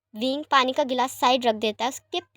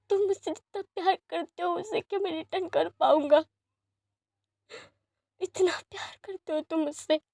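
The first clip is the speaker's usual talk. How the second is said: higher, tearful and overwhelmed; choked-up disbelief; 6 semitones higher, 4 dB quieter than usual. Acted. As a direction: ask it almost crying. Lan Jan overwhelmed, near tears.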